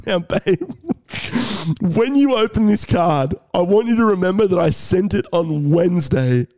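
deliberate, spaced-out instruction to a partner; hammed-up careful disclaimer; careful insistence. Re-enacts careful warning about the card.

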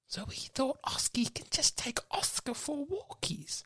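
hushed whispered line; whispering the plan to the sharks. Pretend. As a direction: lean in and whisper it. Whispered aside in pitch.

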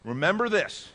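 loud, high, slow scripture reading; preaching scripture. Acted. Pastor reading scripture aloud to the church, preaching at full force. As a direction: proclaim the verses to the congregation.

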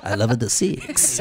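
low purring Latin lover voice, laughing. Acted. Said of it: "Like I'm some sort of Italian lover".

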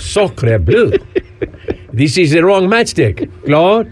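mock French accent, laughing through it; hammed-up French voice. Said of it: "'cause that'll do that accent".

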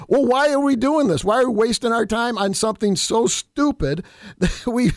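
high-pitched, incredulous questioning; worked-up retold objection; exasperated protest. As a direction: imitate someone fed up at the waste of time. Retold protest over pointless bills.

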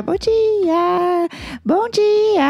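high, drawn-out good morning; high sing-song show-opening call; sing-song greeting. Real, not acted. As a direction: call out a big morning hello, stretching the last word.

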